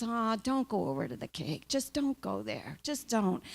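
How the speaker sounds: soft, restrained voice of self-control; hushed, quiet warning against temptation; disciplined inner voice. Acted. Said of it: "that part of your brain that really is disciplined".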